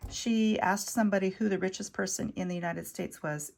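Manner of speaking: normal talking voice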